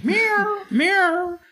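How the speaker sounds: high complaining meow; complaining cat. Retold or imitated. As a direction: whine like a cat. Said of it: "the cat starts complaining".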